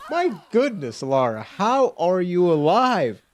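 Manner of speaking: high, drawn-out disbelief; incredulous. Real, not acted. Player asking battered Lara, incredulous. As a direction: stretch out the question in amazement.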